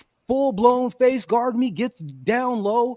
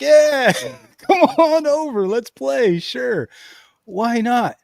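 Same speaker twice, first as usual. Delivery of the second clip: louder, higher, laughing yes; eager welcome; 4 semitones higher, 4 dB louder, slower than usual. Retold or imitated. Retelling accepting the old lady's game, excited and laughing. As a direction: cheerfully invite her over to play.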